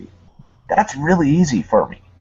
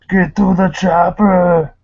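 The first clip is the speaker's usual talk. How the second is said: loud, slow mock-Austrian action hero bellow; badly faked Austrian accent, drawn out and raised; Arnold Schwarzenegger; 7 semitones higher, 7 dB louder than usual. Asked by the host for Schwarzenegger, loud hammed-up impression. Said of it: "just do your best Arnold Schwarzenegger".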